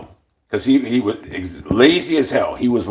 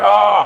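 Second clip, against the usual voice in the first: mock pained "ah", higher and louder; fake groan of pain; 8 semitones higher, 5 dB louder than usual. Worker lying down on the roof, faking a hurt groan. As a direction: ham up a hurt groan to skip work. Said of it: "acting like I was hurt".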